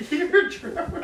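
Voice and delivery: high, slow mocking echo through laughter; laughing mock protest. Friend mocking the girl's rat complaint, laughing high. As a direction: parrot the complaint while laughing.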